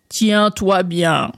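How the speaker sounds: very slow, drawn-out frog voice; frog character. Acted. Frog warning André to hold on, slow and drawn out. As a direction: draw out each word like an old frog.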